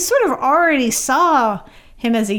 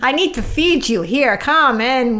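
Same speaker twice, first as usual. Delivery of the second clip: higher, doting Yiddish mother voice; Yiddish accent; 5 semitones higher than usual. Pretend. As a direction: coax him in like a Yiddish grandma.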